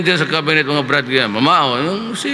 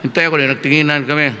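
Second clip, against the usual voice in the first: usual speaking voice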